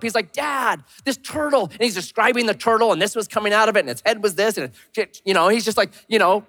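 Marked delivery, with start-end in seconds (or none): 0.3-1.6 higher-pitched imitation of an upset little boy; upset child. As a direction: lightly mimic a distraught toddler telling his dad. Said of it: "man, he was tore up".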